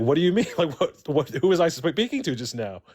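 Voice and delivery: high, startled what do you mean; retold disbelief. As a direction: sound suddenly thrown and confused.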